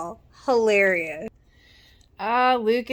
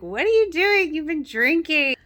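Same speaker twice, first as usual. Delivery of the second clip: higher, louder alarmed brother; mock-alarmed scolding, pitched up; mock Lucas; 8 semitones higher, 4 dB louder than usual. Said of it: "and Lucas is like".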